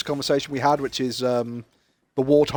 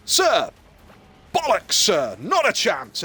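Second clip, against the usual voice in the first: defiant sergeant; 5 semitones higher, slower than usual. Acted. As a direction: flatly refuse the officer, cheeky. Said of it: "the sergeant looks at the general and goes".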